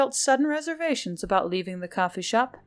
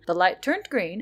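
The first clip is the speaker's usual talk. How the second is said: ordinary delivery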